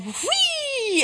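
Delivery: high, gleeful squeal; gleeful whee. Retold or imitated. Throwing confetti off a mountain top, a high gleeful squeal. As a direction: fling it out like confetti from a peak.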